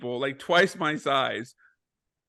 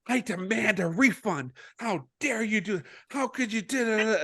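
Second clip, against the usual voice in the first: higher-pitched mock outrage; imitated indignant complaint; 7 semitones higher, 4 dB quieter than usual. Rejected riders complaining to staff, imitated indignation in a higher voice. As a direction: put on a fussy customer making a scene. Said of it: "they're making this huge scene".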